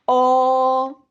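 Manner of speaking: a loud, open O that lets the pencil drop; open vowel O. Shown as a demonstration. Teacher showing students the pencil falling on O. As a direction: throw the O out with the jaw open. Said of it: "Coloco el lapicero".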